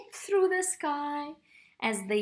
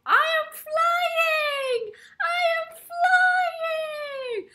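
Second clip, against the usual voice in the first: joyful cry; 7 dB louder, much slower than usual. Acted. Baby Bluebird finally flies.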